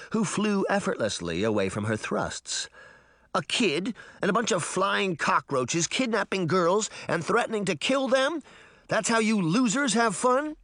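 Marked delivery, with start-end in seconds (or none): 3.3-10.6 high, incredulous scolding; indignant, rising rant; outraged tirade. A narrator's reading. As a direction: rail at them in high-pitched disbelief. Sabrina's outraged tirade at kidnappers.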